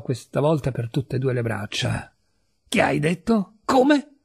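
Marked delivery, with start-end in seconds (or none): 2.7-4.1 startled question, leaping much higher; alarmed disbelief. A narrator's reading. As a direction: react in sudden shock. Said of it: "Il volto di Tito s'abbuiò ad un tratto".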